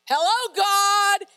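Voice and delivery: loud, drawn-out call to get God's attention. Acted. Preacher voicing a prophet to an unanswering God, loud exasperated call. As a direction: call up to a God who isn't answering, stretching the words. Acts Habakkuk calling out to God.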